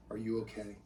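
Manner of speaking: very soft, tender question; hushed motherly care; gentle mom. Acted. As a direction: check on a hurt child quietly. Mom gently checking on son.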